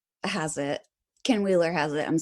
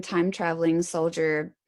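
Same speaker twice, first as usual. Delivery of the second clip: ordinary talk, nothing marked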